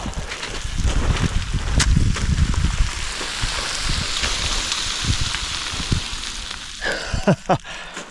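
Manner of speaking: long airy breathless laugh. Real, not acted. Hiker laughing at the steep gravel, breathless airy laugh. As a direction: laugh helplessly at how steep it is.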